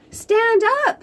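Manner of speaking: high, loud shout of encouragement; called out. Acted. Bob shouting to Pete on his board, loud and high. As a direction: yell it across the waves.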